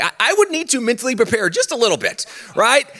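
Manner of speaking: usual speaking voice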